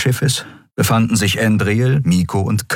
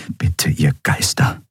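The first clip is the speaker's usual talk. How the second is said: slow, hushed plea; pleading; 3 semitones lower, slower than usual. A narrator's reading. Nelen begging the spirits for protection, pleading. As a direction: plead slowly, almost like a prayer. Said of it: "flehte Nelen".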